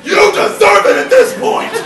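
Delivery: very loud, high comic outburst; exasperated yell. Acted. Fed-up teammate to the friend who flopped in vomit, exasperated yell. Yells at friend lying in mess.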